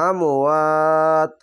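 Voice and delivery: drawn-out chanted reading of তাকুলু, vowels held long; slow Quran recitation. Shown as a demonstration. Teacher demonstrating tajweed to students, slow chanted recitation. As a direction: recite তাকুলু slowly, stretching each vowel. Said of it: "তিন আলিফ টেনে পড়বেন".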